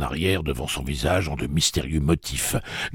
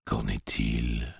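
slow, low, menacingly gentle; terrible soft voice; 4 semitones lower, 5 dB quieter, much slower than usual. A narrator's reading. Tic-Tac questioning Hoots, in a terrible soft voice. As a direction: ask it softly and slowly, with quiet threat. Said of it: "de sa terrible voix douce".

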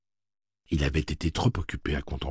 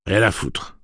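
curt dismissal; 4 semitones higher, 7 dB louder than usual. Acted. Slightman snaps back at Andy.

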